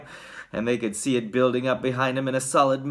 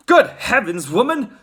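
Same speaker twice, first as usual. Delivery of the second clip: loud, indignant husband; blustering outburst; Mister Gloop; 9 semitones higher, 7 dB louder than usual. A narrator's reading. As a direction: exclaim with exasperation, pitch jumping up. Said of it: "said Mister Gloop".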